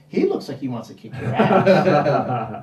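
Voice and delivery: slowed, chuckling through the line; laughing while retelling Shatner's jab; laughing quote. Retold or imitated. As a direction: relay the joke while cracking up. Quoting Shatner's joke amid laughter.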